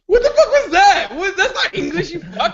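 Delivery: high-pitched protest through laughter; laughing disbelief. Real, not acted. Co-host reacting to a terrible English accent, laughing disbelief. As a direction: crack up while objecting. Cracking up at bad accent impression.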